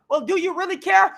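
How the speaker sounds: ordinary delivery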